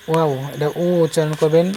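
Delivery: ordinary delivery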